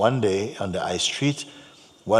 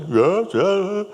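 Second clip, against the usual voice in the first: high, louder mock assent; crusty man agreeing; 11 semitones higher, 6 dB louder than usual. Jamaican man recalls the wise men, loud agreement. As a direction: agree loudly in a pitched-up voice.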